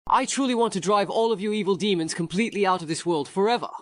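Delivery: high, fast, fervent battle vow; overacted anime dub. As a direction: declare it like an anime hero. Vow to drive out demons.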